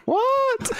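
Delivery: very high retold "what"; incredulous. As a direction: squeak it in mock disbelief. Retold astonished reaction to friend.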